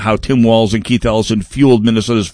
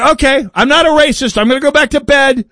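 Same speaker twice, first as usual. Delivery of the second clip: high, put-on naive farmer voice; gullible farmer; 9 semitones higher than usual. Farmer answering the fox accusation, high and foolishly trusting. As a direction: play the duped farmer, going back to bed. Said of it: "And say".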